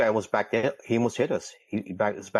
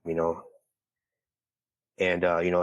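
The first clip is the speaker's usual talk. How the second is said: ordinary delivery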